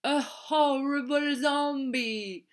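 slow, stretched-out pretend monster; hammed-up Halloween spook; mock spooky voice. A narrator's reading. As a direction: draw every word out to scare playfully. Children scare pupils in costume.

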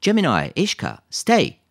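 loud, high call with a drawn-out last word; shouted command. A narrator's reading. Lisa halts her dogs without slowing, shouting. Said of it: "elle cria".

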